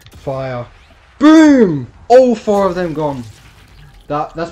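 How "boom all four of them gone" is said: triumphant high shout; excited shout. Real, not acted. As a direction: yell it out in triumph. Sniper kill celebration.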